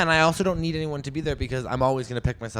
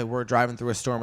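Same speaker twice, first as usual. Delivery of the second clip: ordinary talk, nothing marked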